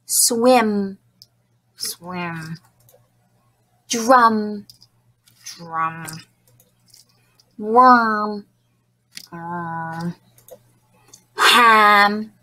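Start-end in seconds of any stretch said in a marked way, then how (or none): 1.8-2.6 lower, softer puppet repeating; quiet puppet echo. Shown as a demonstration. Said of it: "You and Steve will repeat". 5.4-6.1 lower, softer puppet repeating; quiet puppet echo. Shown as a demonstration. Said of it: "You and Steve will repeat". 9.3-10.1 lower, softer repeat of "Worm"; quiet puppet echo. Shown as a demonstration. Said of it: "You and Steve will repeat".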